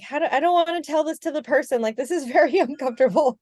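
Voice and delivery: high, rushed, squirming discomfort; acted reluctance. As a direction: act out dreading telling someone, fast and high. Voicing a nervous beginner's reluctance.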